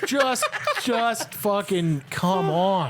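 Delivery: incredulous, drawn-out plea; exasperated mock pleading. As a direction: drag it out in mock despair. End of the comic rant.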